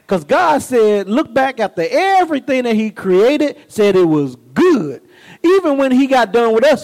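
ordinary talk, nothing marked

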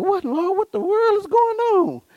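high baffled wail; bewildered. Acted. Man watching his wife barely eat, bewildered. Baffled husband's thoughts at table.

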